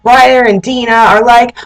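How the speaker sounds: ordinary talk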